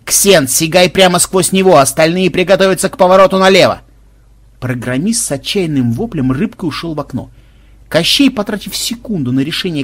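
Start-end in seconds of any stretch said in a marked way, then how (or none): 0.0-3.8 loud, raised battle orders; shouted command. A narrator's reading. Team leader to his squad in a chase, shouted command. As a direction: call orders out loudly over a chase.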